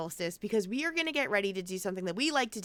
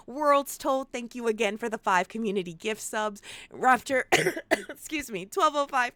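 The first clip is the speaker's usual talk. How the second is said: ordinary talk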